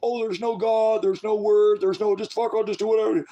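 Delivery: mocking high put-on voice. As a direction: sneer out a godless shrug. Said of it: "just walking around this".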